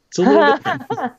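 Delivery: high, fast hammy stage voice; acting voice. Shown as a demonstration. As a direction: put on a lofty theatre voice. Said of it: "my nice little acting voice".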